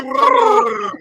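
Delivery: "water" loud and high, as if underwater; mock underwater gargle. Mate mocks foreigner's accent, "water" as if underwater. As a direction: drag out "water" like someone talking underwater. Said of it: "You sound like you're underwater".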